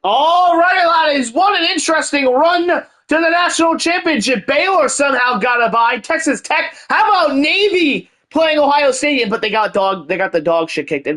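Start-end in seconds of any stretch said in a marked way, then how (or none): 0.0-9.3 excited recap. Acted.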